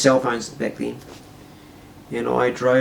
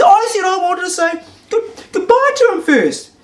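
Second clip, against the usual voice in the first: put-on lampoon the girlfriend as shrill and indignant; cartoonish high-pitched girlfriend demanding answers; girlfriend's angry question; 6 dB louder than usual. Girlfriend voiced in heated quarrel.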